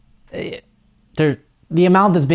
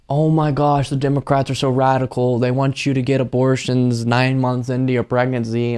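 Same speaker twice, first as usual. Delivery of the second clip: whiny mock panic on one raised, unvarying note; mock right-wing alarmist; 2 semitones higher than usual. Host mocking right-wing claims about abortion, mock-alarmed monotone. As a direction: drone it like an exaggerated, hand-wringing complainer. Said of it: "the right wing constantly saying".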